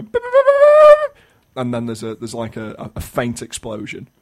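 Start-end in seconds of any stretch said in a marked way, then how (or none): 0.0-1.2 high beeping. Retold or imitated. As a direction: beep like a machine. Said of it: "they go".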